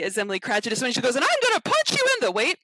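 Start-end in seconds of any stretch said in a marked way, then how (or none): 1.1-2.3 much higher, louder, fast diva threat; shrill-pitched Piggy-style indignant threat; Miss Piggy. Retold or imitated. Emily Cratchit threatening Scrooge, high fast diva voice. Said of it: "Missus Piggy".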